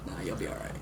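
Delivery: soft reassuring voice; gently reassure, quiet. Retold or imitated. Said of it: "they're gonna be like".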